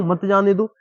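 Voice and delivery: "मत जाने दो" said as one unbroken phrase; मत joined to जाने दो. Shown as a demonstration. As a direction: start afresh on "मत", straight into "जाने दो". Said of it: "यहाँ पे लगा सकता हूँ". ("मत जाने दो" बिना रुके एक साथ)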